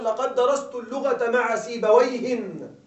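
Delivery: slow example, سيبويهن said ending in -in; سيبويهٍ with nunation. Shown as a demonstration. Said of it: "أنت تقول مثلًا". (نطق سيبويهٍ بالتنوين ببطء)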